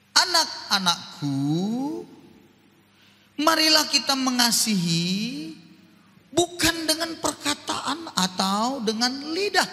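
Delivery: slow, loud, rising proclamation; scripture read with force. Acted. Preacher reads the Bible verse slowly and loudly to the church.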